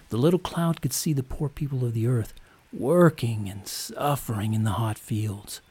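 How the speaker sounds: ordinary delivery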